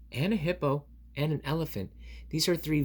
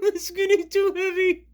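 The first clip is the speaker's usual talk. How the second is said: much higher, louder strain; 9 dB louder than usual. Acted. Elephant under the hippo, high strained voice. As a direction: sound crushed by weight.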